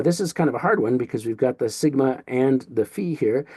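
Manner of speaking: ordinary talk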